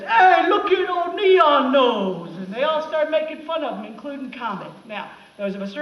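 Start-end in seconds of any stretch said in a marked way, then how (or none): 0.0-2.3 slow, loud jeering New York reindeer; mocking shout. New York reindeer jeering at Rudolph, slow loud mocking shout. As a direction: taunt him across the field like a bully. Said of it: "reindeer says".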